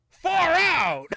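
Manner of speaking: loud, high "Far out!"; excited shout. Retold or imitated. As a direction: yell it with delight. Retold excited reaction.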